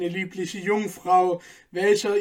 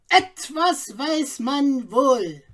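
higher, drawn-out know-all; knowing bystander; 7 semitones higher, 3 dB louder, slower than usual. A narrator's reading. Nearby man chimes in, slow and knowing. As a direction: savour the secret slowly. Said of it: "fiel ein Nahestehender ein".

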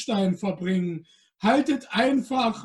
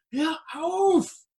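hissed; 8 semitones higher than usual. Acted. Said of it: "zischte Le-, Helene".

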